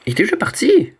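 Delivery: high, loud disbelief; startled question. Real, not acted. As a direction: yelp in shocked disbelief. Caught off guard in game. (surprise aiguë)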